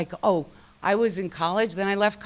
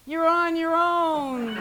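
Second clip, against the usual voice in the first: high, slow, laughing mock yell; yelling back; 10 semitones higher, 3 dB louder, much slower than usual. Big sister yelling to her brother from her room, a laughing mock yell. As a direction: call it out through a laugh, drawn out. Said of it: "I'm yelling".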